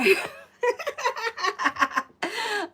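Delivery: high cackling laugh; long burst of helpless laughter; big laugh. Real, not acted. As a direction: crack up in high, rapid peals. Laughing at chat message.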